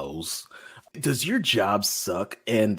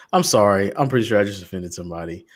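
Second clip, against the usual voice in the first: ordinary talk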